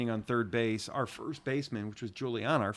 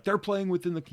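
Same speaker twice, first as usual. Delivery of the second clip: raised, higher-pitched mock yell; retold yell; 8 semitones higher, 3 dB louder than usual. Coach retelling the umpire's rebuke, mock yelling. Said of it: "like, yelled it".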